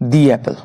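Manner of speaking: দি as "dee" before Apple; long "ee" in দি, then Apple. Shown as a demonstration. Teacher revises the vowel rule, দি as "dee". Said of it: "তার উচ্চারণ হবে".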